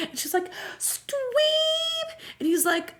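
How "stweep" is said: very high, drawn-out squeal; mock pining Claire. Claire answering monster Steve, high mock pining. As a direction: whimper the name in hammed-up pity. Acts Claire's tearful reply.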